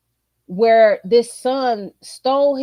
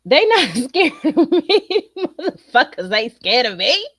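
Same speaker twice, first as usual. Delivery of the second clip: laughing; 2 semitones higher, 4 dB louder than usual. Real, not acted. Mocking boys' fearless attitude.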